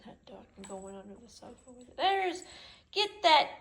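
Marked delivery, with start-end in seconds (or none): 2.0-3.5 high, loud egging-on voice; pet talk. Real, not acted. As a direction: egg the puppy on. Urging dog to play.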